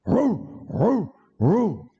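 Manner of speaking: short high dog yelps; imitated dogs baying. Dogs chasing escaped convicts, imitated short high barks. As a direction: yip like tracking dogs in pursuit. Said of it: "the dog's up".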